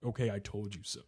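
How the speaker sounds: low, quiet, cold delivery; real cold. Retold or imitated. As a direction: imitate an unmoved father. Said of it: "he's just, like, real cold".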